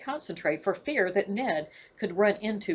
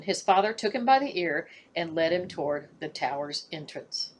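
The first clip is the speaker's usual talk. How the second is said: plain reading voice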